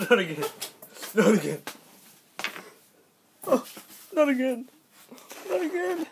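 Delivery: high, rising panicked cries; distressed. Real, not acted.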